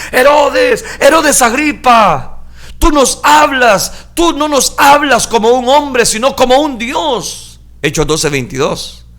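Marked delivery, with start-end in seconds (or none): 0.1-7.6 high, loud, slow acclamation; crowd shouting. Acted. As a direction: shout up to the king like a crowd hailing a god. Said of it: "la gente empezó a gritar". (gritos de una multitud que aclama)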